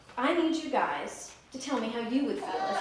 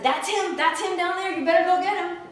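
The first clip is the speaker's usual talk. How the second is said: urging rabbit; 7 semitones higher, 7 dB louder than usual. Acted. Rabbit urges lion into well.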